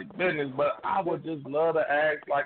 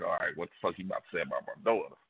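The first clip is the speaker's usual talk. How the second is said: fast low mutter; 9 semitones lower, 6 dB quieter, much faster than usual. Retold or imitated. Inner thought before principal talk.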